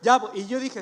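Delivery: ordinary delivery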